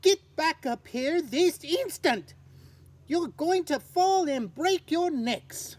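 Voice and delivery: angry mother bird scolding. Acted. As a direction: tell the chicks off in alarm. Mother bird scolds her chicks.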